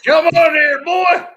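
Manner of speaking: yelling like a mock West Virginia yokel calling folks over; bad West Virginia accent. Calling friends onto the chat, a loud, high mock West Virginia holler. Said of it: "That's a bad interpretation".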